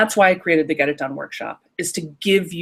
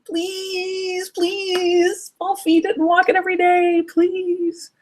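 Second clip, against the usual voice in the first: put-on begging child; 13 semitones higher, much slower than usual. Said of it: "or it's saying".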